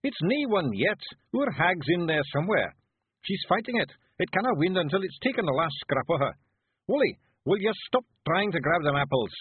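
high, loud Scottish little-man voice; urgent Feegle. Acted. Feegle reporting on the hag's fight, urgent. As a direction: call out excitedly in high Scots.

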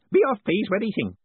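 put-on high, lively elderly wizard; sprightly old wizard. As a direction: chirp away like a cheery old man. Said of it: "said the disembodied voice".

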